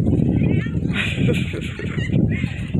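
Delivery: long, high-pitched laugh; amused laugh. Real, not acted. Hiker laughs at Tony shooting down below, amused. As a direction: burst into a run of high laughter.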